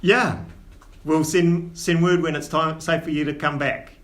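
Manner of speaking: lightly voiced Cockney dwarf dad, scolding but fond; dwarf father in Cockney. Old dwarf father chiding his son, lightly Cockney.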